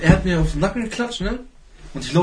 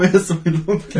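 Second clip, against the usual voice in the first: slightly raised, higher mock yell; retold shouting; 5 semitones higher, 3 dB louder than usual. Retelling the friend shouting over the bleeding speaker, mild mock yell. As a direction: imitate his yell, half-hearted. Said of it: "der schreit so rum".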